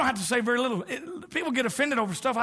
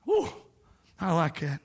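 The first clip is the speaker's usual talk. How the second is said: ordinary talk, nothing marked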